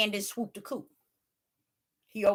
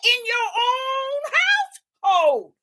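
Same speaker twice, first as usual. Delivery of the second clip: quoting the Father; 11 semitones higher, 5 dB louder than usual. Retold or imitated.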